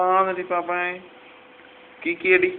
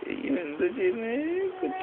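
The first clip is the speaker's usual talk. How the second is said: high, soft cooing question; 9 semitones higher, 8 dB quieter than usual. Real, not acted. Parent asking a little child what they are doing, high baby talk. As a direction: lift it high and coo, rising all through.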